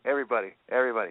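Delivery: rattled off fast. Real, not acted. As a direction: rush the word everybody. Rapid call for attention.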